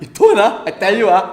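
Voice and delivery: loud, high Manglish scold, laughing; Malaysian accent. Retold or imitated. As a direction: threaten like a Malaysian auntie. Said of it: "in the captain's chair she go like".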